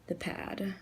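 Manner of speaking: soft whisper while concentrating; whispered aside. Real, not acted. Whispering to viewers while painting a nail. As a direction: breathe the word out under your breath.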